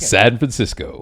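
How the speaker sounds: slow Takei impression through laughter; lightly voiced Sulu, slow, laughing; George Takei voice. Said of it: "Sulu is one of the few, uh, voices I can do".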